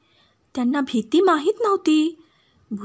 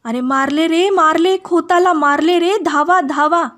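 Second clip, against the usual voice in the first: loud, higher alarmed shout for help; panicked old woman's loud call; crying out for help; 4 semitones higher, 7 dB louder than usual. A narrator's reading. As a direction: yell that the master has been killed. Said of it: "एका म्हातारीने ओरड केली".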